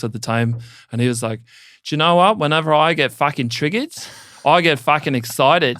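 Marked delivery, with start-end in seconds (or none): 1.8-5.8 put-on terrible Aussie accent. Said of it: "I'm gonna do a terrible Aussie, Aussie accent".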